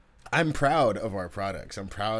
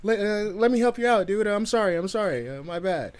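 high, alarmed retold apology; hurried sorry-dude, pitched up; flustered apology; 10 semitones higher than usual. Retold panicked apology to injured man.

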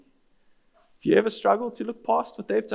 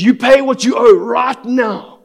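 loud, high, slow furious shout; angry demand; 7 semitones higher, 13 dB louder than usual. Acted. Creditor choking the man who owes him, angry demand. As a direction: shout it slowly, every word a threat.

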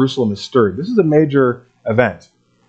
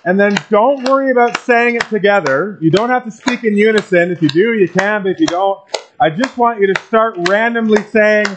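projected higher, calling over clapping; raised voice; 7 semitones higher than usual. Real, not acted. Pastor instructing a clapping congregation, raised high voice. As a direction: raise your pitch to instruct a clapping crowd.

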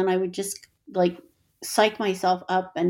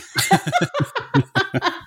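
loud laugh; 11 semitones higher, 4 dB louder than usual. Real, not acted. Laughing after the friend's joke.

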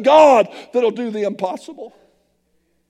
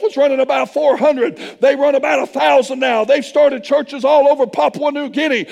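ordinary delivery

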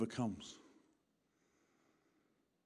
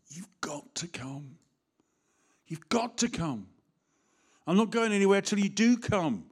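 urgent pleading; 5 semitones higher than usual. Retold or imitated. Voicing Jairus pleading.

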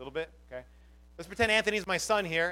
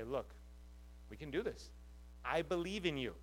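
gentle encouragement; 3 semitones lower, 12 dB quieter than usual. Pretend. Arm around someone, encouraging.

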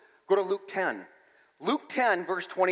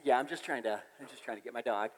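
sheepish; 7 semitones lower, 8 dB quieter than usual. Retold or imitated. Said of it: "I said".

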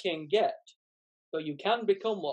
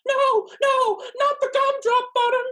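high, loud squeaky panic; panicked gingerbread man; 7 dB louder than usual. Acted. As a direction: plead frantically in a tiny voice. Gingerbread man being tortured.

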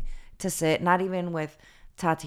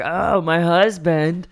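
mocking boast; 4 semitones higher, 9 dB louder, slower than usual.

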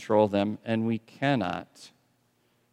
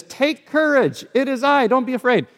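Jesus calling out; 13 semitones higher, 4 dB louder than usual. Retold or imitated. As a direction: call out to frightened friends on the lake. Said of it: "and he says".

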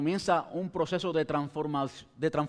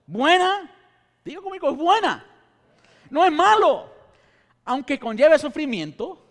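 loud, fervent sermon delivery; preaching; 9 semitones higher, 10 dB louder than usual. Acted. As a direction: preach it with rising fire. Preaches at full force.

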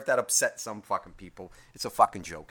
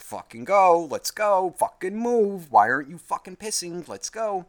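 raised, pressing urging; impatient rushing; 5 semitones higher, 6 dB louder, slower than usual. Retold or imitated. Owner rushing a dog to pee, impatient. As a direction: hurry the dog along, pitched up and insistent. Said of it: "rushing her".

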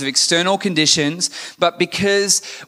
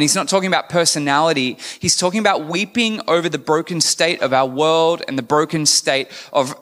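ordinary talk

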